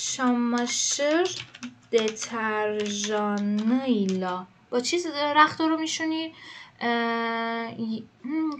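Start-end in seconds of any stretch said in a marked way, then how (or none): 0.1-4.4 very slow dictation. Shown as a demonstration. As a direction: stretch deterjanıyla out syllable by syllable. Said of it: "dictating word slowly".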